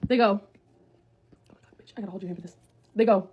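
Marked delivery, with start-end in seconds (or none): 0.4-2.6 hushed bad-news warning. Stranger about to break terrible news, hushed. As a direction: whisper sinking into a soft, low murmur.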